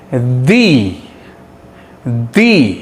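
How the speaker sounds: "the" said low as short thuh. Shown as a demonstration. Teacher modelling the weak pronunciation of the. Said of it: "इसका pronunciation देखते हैं".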